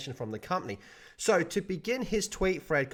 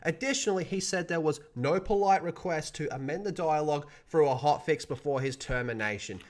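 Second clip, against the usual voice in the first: ordinary talk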